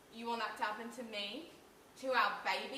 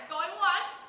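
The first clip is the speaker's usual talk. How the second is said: mock auctioneer call; 6 semitones higher, 9 dB louder than usual.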